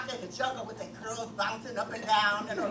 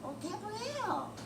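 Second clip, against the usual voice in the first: admiring; 8 semitones higher, 7 dB quieter, slower than usual. Real, not acted. Savoring the audience member's name.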